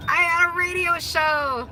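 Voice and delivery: high, giddy singsong brag; excited announcement. Real, not acted. Host telling viewers about her first radio show, excited.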